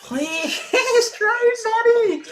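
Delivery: half-laughing desperate plea; laughing high plea. Real, not acted. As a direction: squeak the plea out as you crack up. Pleading, then laughing.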